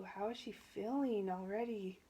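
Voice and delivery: plain reading voice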